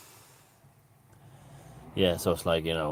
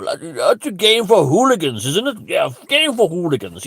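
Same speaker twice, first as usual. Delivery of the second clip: high, loud posh disdain; mock posh accent; 6 semitones higher, 5 dB louder than usual. Comedian mimicking snobs on football, mocking posh accent. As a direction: look down on it in affected posh tones.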